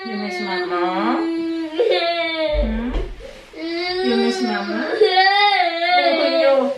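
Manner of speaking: high, slow, tender sing-song. Real, not acted. Mother comforting crying baby.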